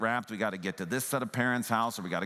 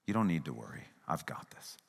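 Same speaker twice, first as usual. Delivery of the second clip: softer, gentle reassurance fading to a whisper; calm a worried child; kind tone; 6 semitones lower, 5 dB quieter than usual. Pretend. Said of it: "your kind tone".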